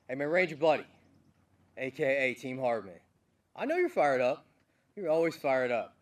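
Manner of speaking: loud, fired-up shout to a buddy; hyped call-out. Real, not acted. Commander loudly hailing his Ranger buddy, fired up. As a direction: hype him up across the room.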